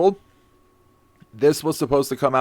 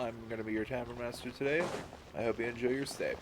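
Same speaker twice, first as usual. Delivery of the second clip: stuffy blocked-nose voice; clogged nose; 16 dB quieter, faster than usual. Real, not acted. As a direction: speak through a clogged nose. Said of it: "If I sound a little clogged".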